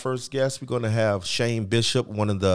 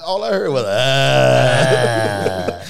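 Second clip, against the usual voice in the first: high, loud hammed-up witness; mock witness voice; 3 semitones higher, 10 dB louder, much slower than usual. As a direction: overplay a frantic witness.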